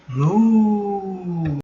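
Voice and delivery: a long moo; cow moo. Acted. The cow mooing hello to the pig.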